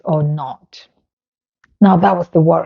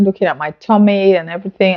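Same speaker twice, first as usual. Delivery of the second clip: ordinary delivery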